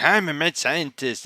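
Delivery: lightly voiced proud declaration; a hint of Okabe announcing himself grandly; Okabe's boast. Okabe boasting to Kurisu, a lightly voiced proud claim. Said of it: "conversation between Kurisu and Okabe is like".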